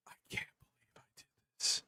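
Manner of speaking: breathy embarrassed whisper under the breath. Real, not acted. Watching his old video, he whispers in embarrassment. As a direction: mutter it to yourself, barely voiced.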